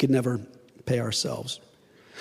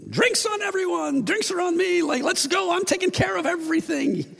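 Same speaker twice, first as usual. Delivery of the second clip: much higher, bubbly party call; giddy generosity; 13 semitones higher than usual. Retold or imitated. Forgiven man buying everyone drinks, giddy and high-pitched. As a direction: throw the bill at the whole bar, thrilled. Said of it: "just be like".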